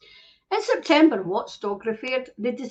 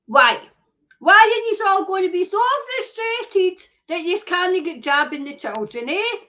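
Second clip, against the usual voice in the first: loud, high indignant demand; angry outburst; 5 semitones higher, 5 dB louder than usual. Real, not acted. Ranting at ministers over jabbing children, angry shout. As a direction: fire the question at them, furious.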